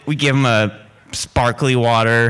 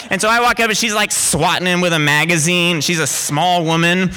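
ordinary delivery

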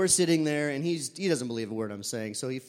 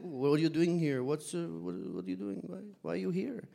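lightly imitated French accent, softer as it goes on; 3 semitones higher, 4 dB quieter than usual. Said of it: "they have this very beautiful French accent".

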